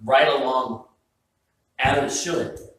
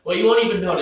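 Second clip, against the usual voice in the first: mock reassurance; 7 semitones higher, 7 dB louder, faster than usual. Mocking the cook's promise.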